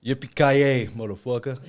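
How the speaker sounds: lightly mocked New York accent; loud opener in a hint of New Yorkese; New Yorker. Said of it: "like a New Yorker saying".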